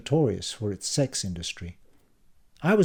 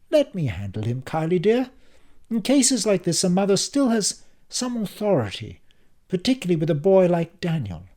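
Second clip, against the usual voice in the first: stern mother voice; 8 semitones higher, 5 dB louder than usual. A narrator's reading.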